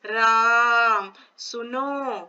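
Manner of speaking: राम सुनो with the vowel held three times as long, very slow; prolonged pluta vowel. Shown as a demonstration. Teacher demonstrating pluta to students: राम सुनो drawn out slowly. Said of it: "तो इसमें तीन गुना ह्रस्व स्वर से ज्यादा समय".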